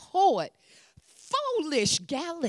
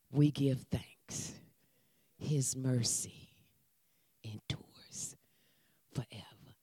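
hushed and unvoiced, drawing the room in; whispered; 10 semitones lower, 10 dB quieter than usual. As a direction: whisper it almost silently, fading out. Whispered fading close of point.

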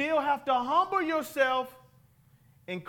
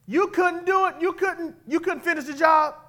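mocking taunt; 7 semitones higher, 6 dB louder than usual. Mocking the overconfident army.

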